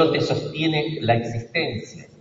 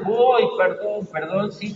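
mock whimpering apology; 8 semitones higher than usual. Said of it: "se ha quedado llorando".

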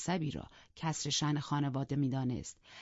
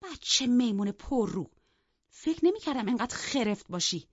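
astonished; 6 semitones higher, 4 dB louder than usual. Acted. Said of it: "با حیرت گفت".

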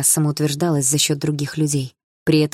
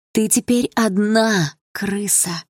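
sneering bully; 5 semitones higher, much slower than usual. Acted. Bully taunt.

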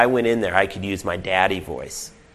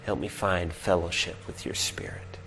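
soft, unvoiced whisper of prayer; hushed prayerful whisper; 5 semitones lower, 11 dB quieter than usual. Real, not acted. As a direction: breathe the prayer quietly to God. Whispered prayer opening sermon.

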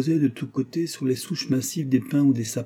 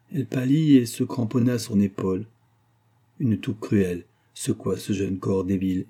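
usual speaking voice

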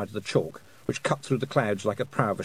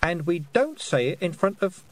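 leading reprimand; 7 semitones higher than usual. A narrator's reading. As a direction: leave it hanging for the child to finish. Sister prompting scolded child.

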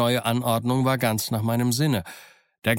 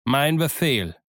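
curt; 4 semitones higher, slower than usual. A narrator's reading. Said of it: "kurz angebunden".

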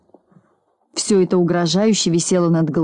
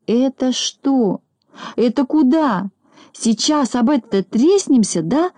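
high-pitched, drawn-out fright; acted alarm, voice raised in pitch; panicky babbling; 6 semitones higher, slower than usual. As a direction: whimper out scared questions, stretching the words. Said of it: "залопотал он".

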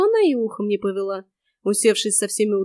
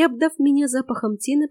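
ordinary delivery

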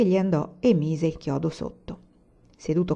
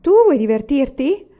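higher, louder teasing question; mischievous; 7 semitones higher, 7 dB louder than usual. A narrator's reading. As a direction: tease him slyly. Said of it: "guardandolo maliziosamente". (tono malizioso)

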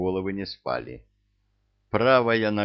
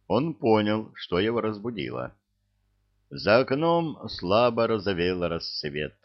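usual speaking voice

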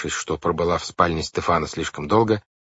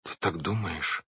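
very soft, slow question; shuddering dismay; 9 dB quieter than usual. A narrator's reading. Violet shuddering at her brother's theory, hushed dismay. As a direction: ask it hushed, with a touch of dread. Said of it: "Вайолет содрогнулась".

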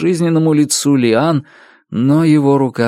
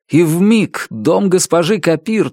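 normal talking voice

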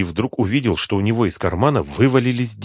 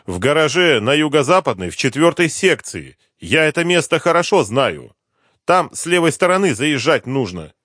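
higher-pitched voice of a pleading snitch; anxious addict informant; 7 semitones higher, 4 dB louder than usual. Acted. Addict tells the inspector about hidden bodies, high insistent voice. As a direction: play the informant eager to be believed.

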